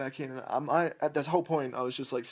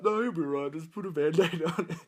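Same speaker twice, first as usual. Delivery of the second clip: higher, laughing, breezy dad voice; dismissive dad; 6 semitones higher, 4 dB louder than usual. Retold or imitated. Dad waves off the bleeding son, dismissive. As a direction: brush it off like it's nothing. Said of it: "he's like".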